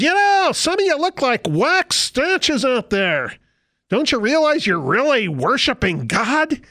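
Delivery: lampoon a leader chiding the crowd; mock worship leader rebuke. Preacher mimics a worship leader scolding his congregation, high and preachy.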